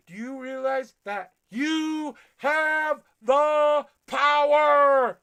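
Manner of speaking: preacher's climactic scream; full-force yell. Acted. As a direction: bellow the word power at them. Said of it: "I'm gonna yell at you".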